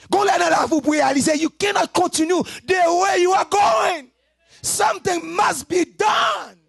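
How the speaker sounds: high, loud sung-shouted plea; fiery preaching. Acted. Preacher urging the listeners, high and loud. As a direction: push the call to stop at full force.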